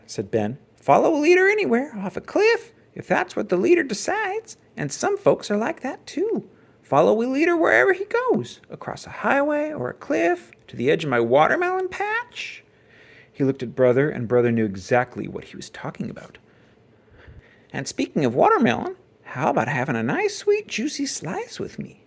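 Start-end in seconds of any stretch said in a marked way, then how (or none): 0.8-12.6 higher farmer voice, gently pointed; a farmer making his point to the cub; wise old farmer. A narrator's reading. 17.7-22.0 put-on friendly old farmer. A narrator's reading. Farmer offers watermelon kindly.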